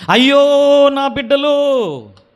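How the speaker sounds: put-on God lamenting his children; grieving lament. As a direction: cry out slowly like a grieving father. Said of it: "ఏడుస్తాడు దేవుడు".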